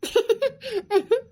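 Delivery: giggly bout of laughter; gleeful laugh. Acted. Boy pleased with his trick, laughing. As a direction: laugh in short bursts.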